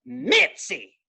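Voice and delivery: a light Southern twang on "Mitzi", drawn out and called high; hint of a Southern neighbor calling "Mitzi!". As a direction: call "Mitzi!" in a mild Southern drawl. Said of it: "the way he said Mitzi".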